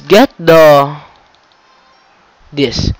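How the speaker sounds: ordinary delivery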